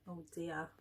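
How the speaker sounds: plain reading voice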